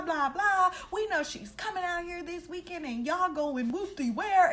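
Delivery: high mocking voice of the drunk friend; high, mocking imitation of a drunk friend exposing secrets; drunk friend blurting. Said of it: "she was like".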